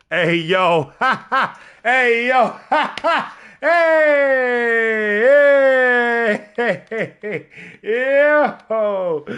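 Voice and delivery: loud, high calls with laughter; excited hooting. Real, not acted. Streamer hooting at the match on screen, loud and rowdy. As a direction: whoop it out loud and high, cackling between calls.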